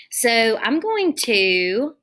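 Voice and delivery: ordinary talk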